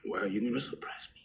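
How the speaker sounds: quick unvoiced whisper. Real, not acted. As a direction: hush it fast, pleading. Whispered complaint about suppression.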